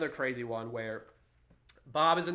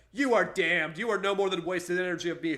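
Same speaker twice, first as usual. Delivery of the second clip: raised, loud, theatrical condemnation; voice of God; 4 semitones higher, 6 dB louder than usual. Acted. God damning the man who resists him, loud acted wrath. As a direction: thunder it as a hammed-up angry God. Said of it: "God says".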